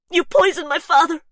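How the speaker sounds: put-on high, loud accented accusation; furious yell. Gabriella yells at Faith about her father, accented. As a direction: hurl it at her in fury. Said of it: "Gabriella yells".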